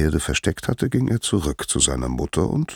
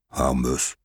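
lightly voiced father Zeus; 5 semitones lower, 4 dB quieter than usual. Zeus addresses his son.

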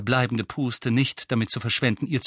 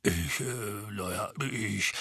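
very quiet, hesitant mumbling; sheepish faltering murmur; uneasy; 3 semitones lower, 9 dB quieter, slower than usual. A narrator's reading. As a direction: stall awkwardly under attack. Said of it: "schien es äußerst unwohl".

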